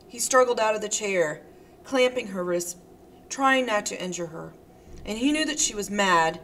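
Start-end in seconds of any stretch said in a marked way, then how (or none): none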